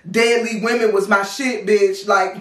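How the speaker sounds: ordinary delivery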